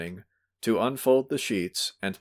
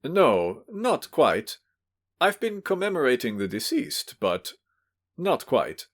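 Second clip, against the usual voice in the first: flustered; 6 semitones higher than usual. A narrator's reading. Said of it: "was a little fluttered".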